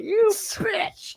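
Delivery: high shrieking "You bitch"; mock shout. Acted outburst in recap.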